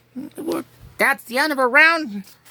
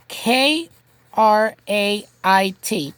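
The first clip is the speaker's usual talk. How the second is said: usual speaking voice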